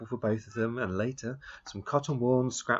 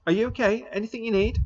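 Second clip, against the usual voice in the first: put-on boyish voice, higher and louder; boy calling out; 12 semitones higher, 4 dB louder than usual. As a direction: call down kindly like a young boy. Omri calls to Little Bull.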